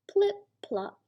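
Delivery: high, playful plip-plop; high sing-song sound words; sound effect. Acted. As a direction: pop the words out high like ears flopping. Ears flop sound.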